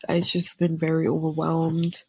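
usual speaking voice

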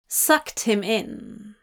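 plain reading voice

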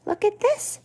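put-on high, bright child voice for Abigail; a girl thrilled by her find; excited little girl. A narrator's reading. Girl Abigail excited over book.

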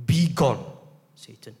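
forceful banishing order, ending hushed; firm command. Acted. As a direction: dismiss him firmly, dropping on the name. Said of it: "he issues a command to Satan".

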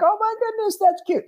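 high, delighted gushing; excited squeal. Retold or imitated. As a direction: squeal over something cute. Delighted cry at otters.

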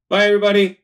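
loud, high cheerful farewell; excited goodbye. Real, not acted. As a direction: shout bye to the listeners.

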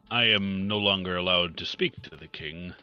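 ordinary talk, nothing marked

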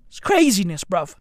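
usual speaking voice

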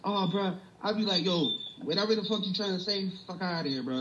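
mock New York confrontation; heated New York accent. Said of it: "That's when that accent really come in".

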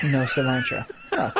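light put-on Spanish twang; little twang. Teasing the friend's perfect Spanish order, light twang. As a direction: give it a slight mock-Spanish twang. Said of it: "put a little twang on it".

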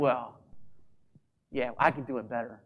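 muttered mock boast; smug inner voice. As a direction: toss it off like a smug rival. Mimicking a jealous inner voice.

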